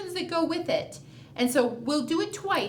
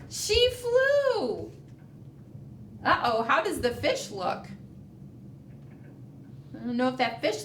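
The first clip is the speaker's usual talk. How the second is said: put-on reading to children; slower than usual. A narrator's reading. Reads picture book aloud.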